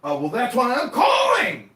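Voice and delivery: high, loud retort; exasperated shout. Retold or imitated. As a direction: shout back in frustration. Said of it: "And I said".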